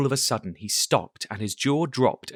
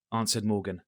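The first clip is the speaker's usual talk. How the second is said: plain reading voice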